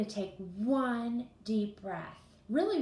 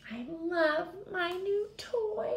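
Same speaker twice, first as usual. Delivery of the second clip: high, slow, delighted Piggy cry; excited Piggy; 8 semitones higher, 6 dB louder, slower than usual. Acted. Piggy showing off her new toy, excited and high. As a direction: gush over the new toy. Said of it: "she's saying it loudly or with lots of expression".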